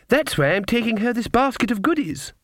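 put-on very high child's voice; little girl. A narrator's reading. Child explaining the basket for granny, childlike. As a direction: pipe it up like a child reciting. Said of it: "the child began".

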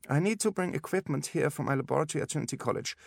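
put-on high-pitched German-accented specialist; businesslike instructions in a German accent; brisk German doctor. A narrator's reading. German doctor figure speaks.

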